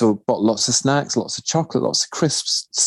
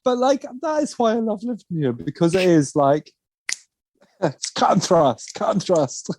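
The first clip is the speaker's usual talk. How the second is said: high, giggly delivery; laughing while defending; 7 semitones higher than usual. Real, not acted. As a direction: cut and thrust through giggles. Still laughing after joke.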